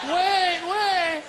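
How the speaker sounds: high, loud call to hold the door. Retold or imitated. Guy running down the hall calls to the elevator, loud and high. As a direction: shout after the closing doors.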